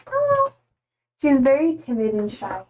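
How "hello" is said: voicing the cat. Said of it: "She's like".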